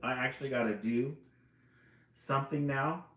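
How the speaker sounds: mock horrified owl. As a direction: jump at the news in alarm. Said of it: "completely horrified".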